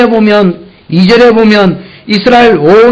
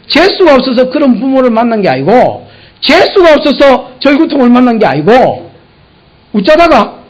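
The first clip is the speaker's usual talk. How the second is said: ordinary delivery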